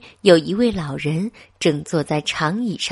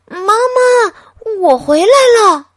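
put-on child calling out much higher and louder; shouting; 13 semitones higher, 9 dB louder than usual. A narrator's reading. Boy shouting to his mother, loud call. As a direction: call across the house to mom. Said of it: "小东尼大喊".

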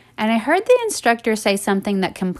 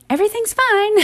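high-pitched, sugary reassurance; sweet, cute instructor; 13 semitones higher than usual. Retold or imitated. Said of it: "she said it all sweet and cute".

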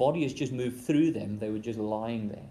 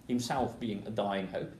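ordinary talk

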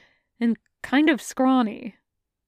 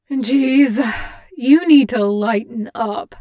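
teasing; 8 dB louder than usual. Acted. Character teasing the brooding Reaper.

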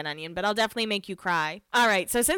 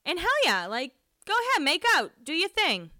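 high, drawn-up egging-on; go-for-it encouragement, pitched high; cheering them on; 8 semitones higher than usual. Real, not acted. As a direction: hype up the new couple in a high voice. Mock cheering on the kissing couple.

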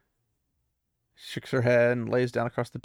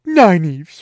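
outraged protest; 4 semitones higher, 11 dB louder than usual. Acted. Gawain protesting Nynaeve's threat.

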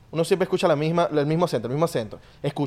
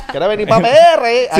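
carcajada y grito agudo, muy fuerte; grito burlón; 7 semitones higher, 9 dB louder than usual. Acted. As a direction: grita en broma con la voz disparada. Hosts yell excitedly, teasing her. (grito agudo de vacilón)

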